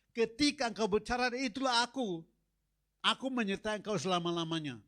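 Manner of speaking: loud, rising proclamation of God's promise; preaching God's words. Acted. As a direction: build it up and proclaim it. (seruan khotbah yang lantang dan berapi-api)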